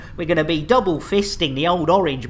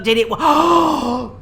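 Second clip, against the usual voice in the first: drawn-out suspense; 4 semitones higher, slower than usual. Real, not acted. Suspense while checking the result.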